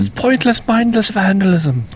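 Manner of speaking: high, soft hammed-up Irish voice; mock Irish accent. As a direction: put on a silly high Irish accent for a jab. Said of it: "you're Irish".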